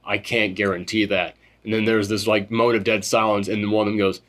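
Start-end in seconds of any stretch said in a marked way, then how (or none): 0.1-1.4 low and quiet, heavy; grave reply. Retold or imitated. Doc answering the veteran, low and quiet. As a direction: drop it low and soft like bad news. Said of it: "Doc just goes".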